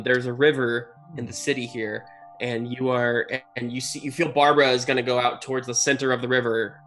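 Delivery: normal talking voice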